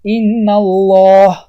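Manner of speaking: "ان الله" drawn out very slowly, the doubled lam of الله thick and heavy; slow tajweed recitation of ان الله with a full lam; heavy lam in Allah. Shown as a demonstration. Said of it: "مثال".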